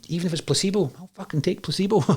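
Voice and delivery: high, exasperated inner thought; desperate inner voice. Retold or imitated. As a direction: say it pitched high with grim humour.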